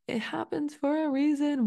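high, chirpy mock cheer; mock sing-song optimism; fake positivity voice. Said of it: "false positivity".

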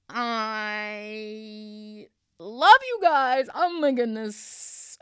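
choked up, very slow; real tears as she thanks viewers; tearful. Real, not acted. Emotional sign-off to viewers.

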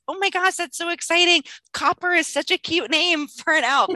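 put-on high, bright-eyed enthusiasm for a child; excited teacher praising a child's elf name. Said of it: "repeating and being excited".

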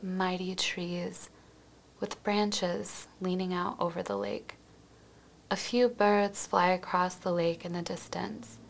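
quiet, even, soothing visualization read; guided meditation. Acted. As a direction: gently guide the listener into calm, leaving space between phrases.

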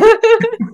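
bright burst of high laughter. Real, not acted. Guest delighted by the host's flattery, high laugh.

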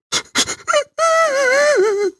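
high wailing sob; exaggerated mock crying wail. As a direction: ham up the bawl. Sob after crying plea.

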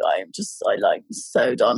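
drained, breathy whisper; exhausted whisper. Retold or imitated. To her partner in the morning, whispered and worn out. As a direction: barely get the words out, spent.

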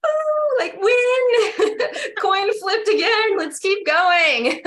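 high, loud laughing cry of triumph; laughing through tears. Real, not acted. Woman cheering her own bold step, laughing and crying.

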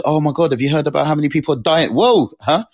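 mock alarm, pitched high; high-pitched, put-on shock; alarmed gossip. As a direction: gasp out the news like shocked gossip. Voices an alarmed person.

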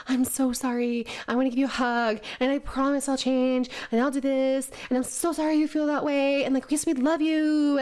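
smother them with sorry; gushing people-pleaser. Retold or imitated. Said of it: "It's just to be like".

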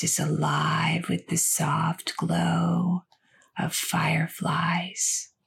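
slow, soothing meditation voice with long pauses; unhurried, stretched words, easing the listener toward sleep; guided sleep meditation. Acted. Host guiding listeners with insomnia into sleep, slow guided meditation.

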